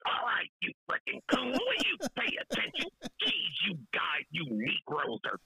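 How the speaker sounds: comic impression of an irate yeller, pitch jumping then dropping; hammed-up angry caller voice; angry caller character. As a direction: play a cranky man berating everyone, barely keeping it together. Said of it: "I can't even do him no more".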